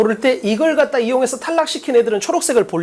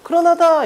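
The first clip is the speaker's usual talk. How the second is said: 그러나다 punched out higher; strong reading; 8 semitones higher than usual. Shown as a demonstration. Teacher showing students how to read a key word, strong reading. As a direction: hit 그러나다 hard with raised pitch. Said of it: "강하게 읽으려고 했는데".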